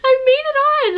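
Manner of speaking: thrilled to finally get through the gate; retold excited squeal. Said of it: "I was like".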